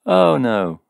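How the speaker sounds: loud, high, falling dismay; dawning realization. A narrator's reading. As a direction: let the awful truth dawn. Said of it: "with sudden dawning realization".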